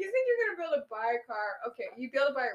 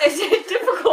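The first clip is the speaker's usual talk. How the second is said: higher, much louder repeat of the question; 6 semitones higher, 10 dB louder than usual. Real, not acted. Host repeats a question to a guest who missed it, loudly. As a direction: blast the question so it gets heard.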